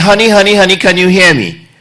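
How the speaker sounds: loud, high call across the house. Retold or imitated. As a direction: call her name from twenty feet away. Husband calling wife from afar.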